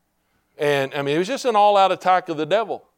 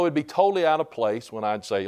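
normal talking voice

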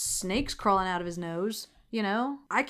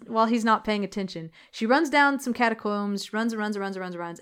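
normal talking voice

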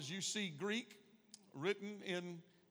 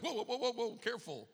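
mock alarm; 5 semitones higher, 3 dB louder, faster than usual. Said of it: "I'm kinda like".

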